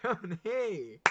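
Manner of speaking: a laughing echo of the name; laughing repeat. Real, not acted. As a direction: say the name again through a laugh.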